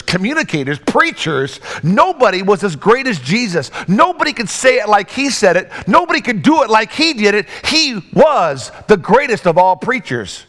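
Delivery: normal talking voice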